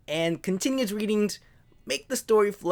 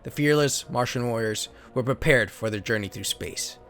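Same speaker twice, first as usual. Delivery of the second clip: lower, steady storybook narrator voice; story narration; 7 semitones lower than usual. Acted. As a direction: read the card like an old sci-fi serial. Reads card story as narration.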